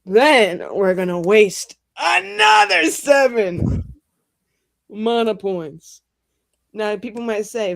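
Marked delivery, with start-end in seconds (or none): 0.1-6.0 loud, slow, spell-casting boast; slow emphatic declaration. Acted. GM to the table, booming announcement of the spell cost.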